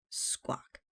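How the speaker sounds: soft, hushed parrot squawk. A narrator's reading. Parrot on Captain's shoulder, squawking very softly. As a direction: squawk it gently, under the breath. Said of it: "very softly".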